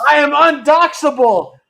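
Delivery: mock boast. Boasting playfully.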